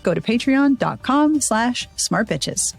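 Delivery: usual speaking voice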